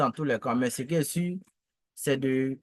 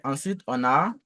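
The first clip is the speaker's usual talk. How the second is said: normal talking voice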